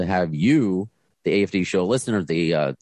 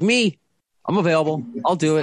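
eager volunteer; 8 semitones higher than usual. Retold or imitated. Host mimics the guest eagerly volunteering, high-pitched. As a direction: mimic a guest jumping at the chance, high and keen. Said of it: "You're like".

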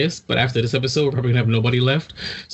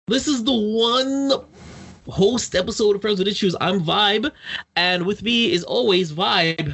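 mock host intro; 5 semitones higher than usual. Mock solo show intro.

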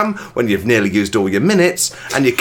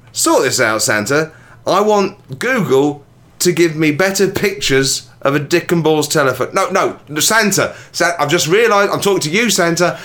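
angry man rant; 7 semitones higher than usual. Acted. Ranting at Google and Santa for a phone, mock-angry. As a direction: berate Santa like a man at the end of his tether. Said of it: "is this you or the angry man".

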